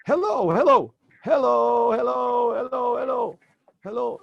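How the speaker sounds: loud, high repeated calls; yelling hello. Acted. Boy calling out to the traveler, yelling. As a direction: call out across the street. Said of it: "hears someone yelling".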